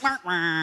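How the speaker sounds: soft, deflated little "womp womp"; a small, sorry honk, played for laughs; imitated hapless bird noise. Co-host imitating poor Lugnut among the robins, deflated little noise. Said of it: "And Lugnut's like".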